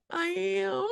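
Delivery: a hint of a pleased kid agreeing; proud little boy.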